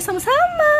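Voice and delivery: sudden high squeal of thanks; delighted thanks. Real, not acted. Thanking viewer Wulan for her praise, squealing with delight.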